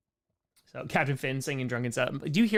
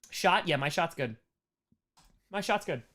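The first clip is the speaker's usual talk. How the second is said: ordinary talk, nothing marked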